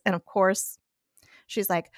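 usual speaking voice

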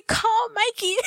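high, tearful-sounding laugh voice; helpless high squeal of a plea; laughing mock distress. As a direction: plead it while cracking up. Cracking up retelling distress text.

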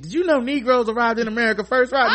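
lightly voiced smug little bookworm; a hint of a know-it-all kid showing off; know-it-all brother. Mock lecturing his brother.

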